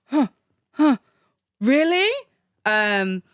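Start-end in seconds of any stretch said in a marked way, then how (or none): 0.0-2.1 much higher, mock disbelieving "really?"; rising, incredulous "huh, huh, really?"; doubtful surprise. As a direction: play the sceptic who can't believe it. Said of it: "you might look at me and think".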